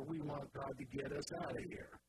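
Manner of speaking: usual speaking voice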